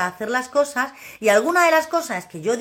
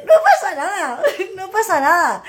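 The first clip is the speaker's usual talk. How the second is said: laughing; 7 semitones higher, 4 dB louder than usual. Real, not acted. Laughing repeat of reassurance.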